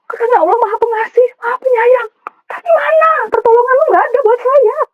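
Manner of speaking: crying out very high at God; tearful, high-pitched reproach; anguished angry outburst. Retold or imitated. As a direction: wail the accusation, pitch soaring. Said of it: "Pokoknya saya ngoceh, saya marah".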